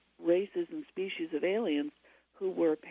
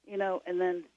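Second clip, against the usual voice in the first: ordinary talk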